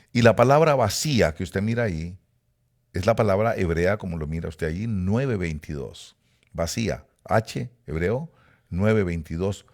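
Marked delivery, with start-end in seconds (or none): none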